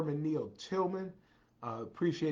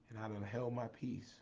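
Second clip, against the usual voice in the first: ordinary talk, nothing marked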